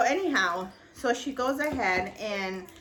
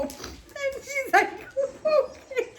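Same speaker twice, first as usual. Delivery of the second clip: high, slow, laughing squeak; can't stop laughing as she tells it; laughing through words; 3 dB louder, slower than usual. Real, not acted. Retelling her sister's okay, high helpless laughter.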